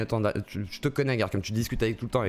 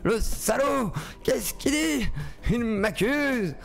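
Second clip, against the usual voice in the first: high, hammed-up outraged voice; high mock outrage, dragged out; mock indignation; 10 semitones higher, much slower than usual. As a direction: ham up the offended reaction, slowly at the end. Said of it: "j'étais là".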